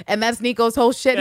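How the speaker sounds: usual speaking voice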